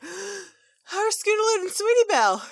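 put-on high, loud, gushing kid voice; excited squeal. Babs telling Apple Bloom about her friends, thrilled. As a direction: squeal it out with delight. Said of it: "Babsy's like".

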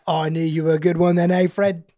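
matey tipsy Cockney; old accent slipping out. Acted. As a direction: chum up to him in a Cockney accent. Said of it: "that's my old accent".

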